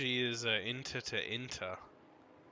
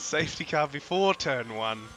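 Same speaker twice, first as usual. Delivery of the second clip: motorsport commentator; 5 semitones higher, 8 dB louder than usual. Acted. Race play-by-play call.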